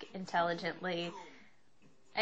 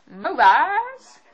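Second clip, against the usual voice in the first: high loud rising "Movies?"; high mock-surprised question; 8 semitones higher, 10 dB louder than usual. Real, not acted. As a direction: question yourself in delight.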